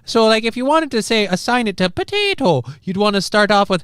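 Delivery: high, lilting stage-Irish voice coach; Irish accent. Acted. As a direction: explain it in a put-on Irish accent. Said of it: "in an Irish accent".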